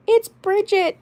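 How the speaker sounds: put-on high squeaky farm girl accent on "It's Bridget". Shown as a demonstration. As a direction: pitch way up into the farm girl doll voice. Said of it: "the Bridget accent".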